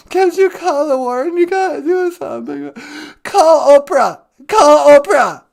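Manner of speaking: loud, high whiny plea; mock desperate begging. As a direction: beg frantically through fake tears. Caller begs for help.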